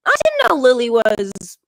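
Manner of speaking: high, loud startled mother; a sudden gasping squeal of realization; mock surprise. Mom interrupting her child's story, high and startled. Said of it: "interrupts me and goes".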